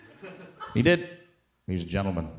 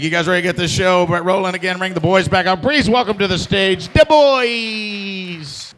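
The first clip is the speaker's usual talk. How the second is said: loud, high emcee call; announcer hype; 9 semitones higher, 8 dB louder than usual. Acted. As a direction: pump up the crowd. Hosts bringing act back.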